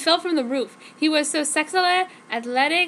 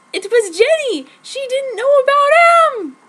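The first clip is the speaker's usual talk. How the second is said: much higher and louder, slowing down in alarm; shocked narration; 13 semitones higher, 11 dB louder than usual. A narrator's reading. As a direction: gasp it out as if caught off guard. Narrator shocked by friend's arrival.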